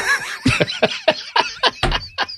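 big high squeal of laughter. Real, not acted. Big laugh after joke.

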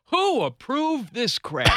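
high, slow mock outrage; a lightly voiced outraged husband, drawn out; husband walking into the remodeled house, slow and indignant; high slow indignation. Washington coming home.

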